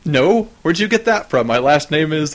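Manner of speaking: indignant denial. Retold or imitated. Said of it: "he's like".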